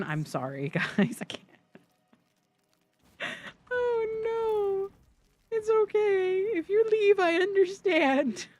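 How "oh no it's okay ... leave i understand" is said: high, whiny fake sob; mock crying. Streamer telling viewers they may leave, mock tearful. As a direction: wail with hammed-up hurt, drawing out the end.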